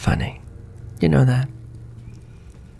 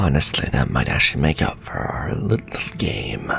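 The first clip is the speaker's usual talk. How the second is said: ordinary talk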